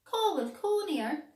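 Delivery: the cat asking brightly where he lives. A narrator's reading. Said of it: "ar son cat".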